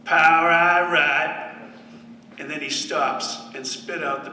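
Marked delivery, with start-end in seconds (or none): 0.1-1.5 slow, louder southern drawl on "Power I ride"; hammed-up Southern huddle call. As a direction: drawl the call like an Alabama quarterback. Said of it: "in his southern drawl".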